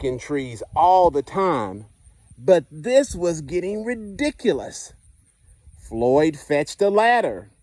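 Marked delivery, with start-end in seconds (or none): none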